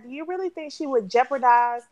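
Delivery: ordinary talk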